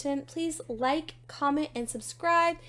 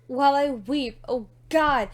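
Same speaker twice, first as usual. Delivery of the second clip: louder, emphatic poetic lament; anguished outcry; 9 dB louder than usual. Acted. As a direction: swell into a dramatic plea. Repeated line in poem, builds.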